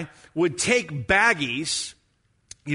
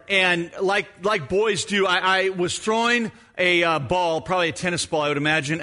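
usual speaking voice

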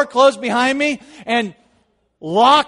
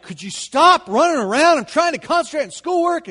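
fed-up mom; 8 semitones higher, 4 dB louder than usual. Retold or imitated. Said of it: "she was just like".

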